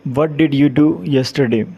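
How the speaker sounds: normal talking voice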